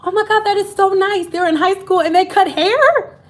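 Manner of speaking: high, excited, clueless misunderstanding; gushing like she's been offered a haircut; hammed-up naive newcomer; naive mock delight. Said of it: "I was like".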